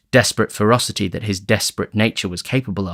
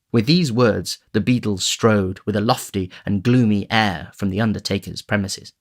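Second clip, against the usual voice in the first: ordinary delivery, nothing marked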